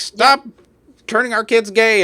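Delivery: hammed-up bigot voice; mocking bigot. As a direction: shriek it like a panicked parent. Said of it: "you're like".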